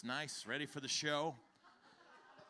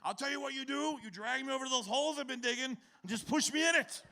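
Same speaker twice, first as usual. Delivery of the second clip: quoted father; 8 semitones higher, 3 dB louder, faster than usual. Retold or imitated. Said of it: "He goes".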